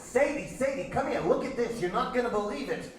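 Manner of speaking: put-on high, excited call in Yiddish accent. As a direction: call the wife over eagerly, Yiddish-accented. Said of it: "forgive my terrible Yiddish accent".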